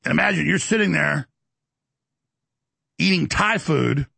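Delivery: ordinary talk, nothing marked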